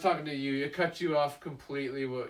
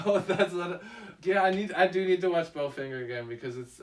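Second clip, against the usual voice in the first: ordinary delivery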